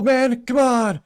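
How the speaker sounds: hammed-up doddering announcer shout; Joe Biden impression. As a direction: yell it like a confused old politician at a fight. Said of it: "he'd probably say".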